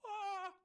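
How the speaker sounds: short, very high, loud yelp; mock scream. Host voicing the guy's diving-board fall with a yelp. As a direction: yelp like a man hitting concrete. Guy hits the concrete.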